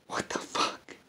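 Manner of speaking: breathy whisper, aghast; whispered disbelief. Real, not acted. Telling viewers about ungrateful doubters, whispered. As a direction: let it slip out under the breath.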